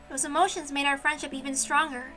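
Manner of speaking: ordinary delivery, nothing marked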